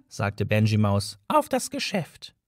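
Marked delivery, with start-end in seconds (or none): 1.3-2.3 high mouse voice on auf das Geschäft; a mouse announcing business; high, tiny mouse voice, brisk; businesslike mouse. A narrator's reading.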